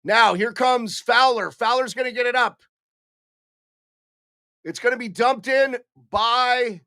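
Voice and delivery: hockey play-by-play call; live play-by-play. Acted. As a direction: call the rush up the ice. Streamer calls the game live.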